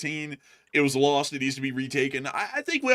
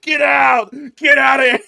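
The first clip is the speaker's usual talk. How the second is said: high, very loud shouts; imitated hollering from bystanders; background people yelling; 9 semitones higher, 12 dB louder than usual. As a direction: yell furiously to chase him out. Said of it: "people in the background yelling".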